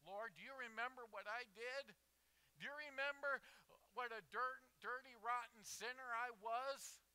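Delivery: loud, high, insistent questioning; impassioned plea. Acted. Sinner reminds the Lord of past sins, loud plea. Acted anguished sinner pleading to God.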